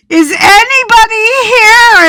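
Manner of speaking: high, loud desperate call; yelling. Retold or imitated. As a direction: yell down an empty hallway for help. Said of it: "And I'm yelling".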